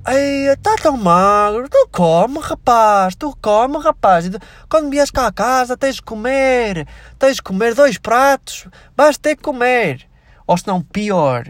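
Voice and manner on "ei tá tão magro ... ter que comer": high, slow mock relative urging food; drawn-out imitation of a relative insisting you eat; hammed-up, slow and high, forcing food on the guest; pushy relative. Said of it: "aquele que é tipo".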